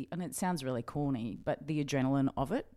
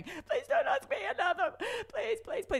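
high-pitched, whimpering plea through laughter; mock pleading. Cadet journalist dreading another question, high mock pleading. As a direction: beg in a squeaky, hammed-up panic. Cadet silently begging not to be picked.

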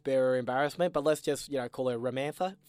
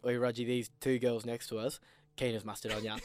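softer, lower confiding tip; mate's tip-off; 4 semitones lower, 8 dB quieter than usual. Retold or imitated. Caldy tipping off his mate about the girls, hushed. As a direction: lean in and tell him quietly. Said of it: "Caldy leans into me and goes".

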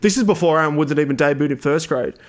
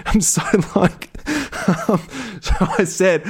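breathless giggling that breaks into a high laugh; can't hold the laugh in; real laughter; 4 semitones higher than usual. Real, not acted. Telling listeners about the fake limp, laughing. Cracking up retelling the prank.

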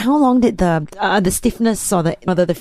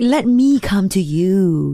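slow, drawn-out salesy line; slow sales pitch voice; much slower than usual. Retold or imitated. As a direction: play the smooth insurance agent, slowly. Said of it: "it's sort of like".

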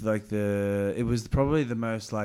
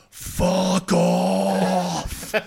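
high, loud drawn-out yell; a cyclist roaring back at the car; beastly roar; 7 semitones higher, 8 dB louder than usual. Retold or imitated. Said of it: "something summoned from the beast".

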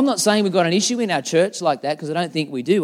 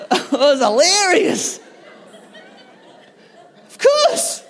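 ordinary talk

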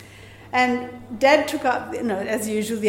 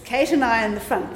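ordinary delivery

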